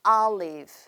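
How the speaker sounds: olive starting with an open "ah", "ah-liv". Shown as a demonstration. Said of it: "아 발음처럼 하시면 됩니다". (olive를 "알리브"처럼 아 소리로)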